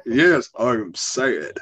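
slow, low deadpan imitation; C J's monotone. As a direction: claim a feeling with robotic flatness. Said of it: "he just monotone through life".

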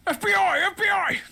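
high, loud mock raid shout; shouting FBI. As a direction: yell it like a raid. Calling out a raid.

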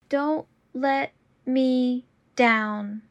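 slow and deliberate. Shown as a demonstration. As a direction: say it slowly and clearly. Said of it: "repetition drill, slowed take".

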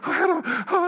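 high-pitched, panting little protest; hammed-up breathless fluster; mock panting. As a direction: gasp it out like someone overexcited. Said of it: "panting like".

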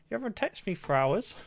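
complaining partner. Retold or imitated. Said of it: "she's like".

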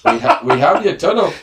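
high, loud mock-Irish villain breaking into laughter; laughing Irish warlord voice; Irish turtle warlord. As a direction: make a comic kidnapper's threat while cracking up. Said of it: "give us an Irish turtle warlord".

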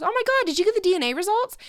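quick, high, loud imitated yell; mock urgent holler across the house; retold yell. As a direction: call it out fast and high, imitating her. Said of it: "Sarah yelled real quick in my house".

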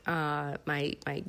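usual speaking voice

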